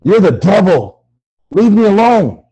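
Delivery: loud, forceful accusation; angry shout. Acted. Victim orders killer doll off, angry shouting. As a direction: shout the caller away. Andy rejects Chucky.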